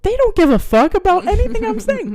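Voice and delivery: high, loud mock amazement; hammed-up epiphany, stretching the ending; astonished realization. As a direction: blurt it high in disbelief. Acted sudden realization.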